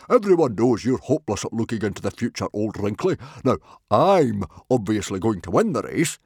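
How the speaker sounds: loud, fast, cocky booming voice; boastful loud chieftain. A narrator's reading. Stoic boasting to old Wrinkly about winning, loud. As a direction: brag like a big Viking chief.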